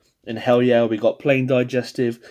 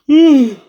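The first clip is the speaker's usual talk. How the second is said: loud, high open-mouthed yawn; big yawn; 16 dB louder than usual. Real, not acted. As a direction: let out a huge noisy yawn. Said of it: "I was, uh, yawning".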